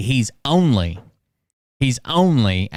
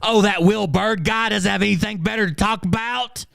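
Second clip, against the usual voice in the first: mocking chat voice; 11 semitones higher than usual. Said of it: "And it's like".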